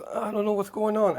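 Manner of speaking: put-on high little girl's voice, frightened; a scared kid who can't understand; panicked child. Small girl freaking out after the attack, frightened. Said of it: "As she goes".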